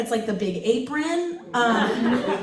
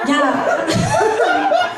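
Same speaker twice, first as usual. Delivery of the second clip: laughing yeah; 11 semitones higher, 7 dB louder than usual. Real, not acted.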